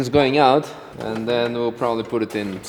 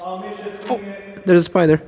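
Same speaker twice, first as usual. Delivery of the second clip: screaming at a spider; 9 semitones higher, 6 dB louder than usual. Real, not acted. Spots a spider while working.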